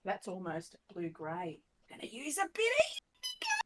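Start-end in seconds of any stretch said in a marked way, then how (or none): 1.9-3.6 playful high voice. Acted. Painting, playful sing-song to self.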